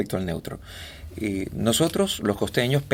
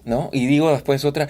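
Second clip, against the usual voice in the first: ordinary delivery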